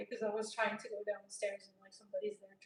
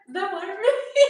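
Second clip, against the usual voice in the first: laughing; 8 semitones higher, 13 dB louder than usual. Retold or imitated. Repeating question while laughing.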